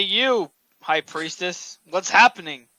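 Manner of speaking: usual speaking voice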